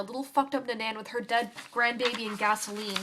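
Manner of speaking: usual speaking voice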